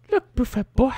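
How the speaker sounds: a hint of Italian accent. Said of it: "I just gave her an Italian accent".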